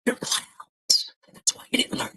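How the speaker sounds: a long taunting whisper of insults; whispered mockery aimed at the audience. Shown as a demonstration. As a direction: breathe the insults out, no voice at all. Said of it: "What if I whisper it like this?".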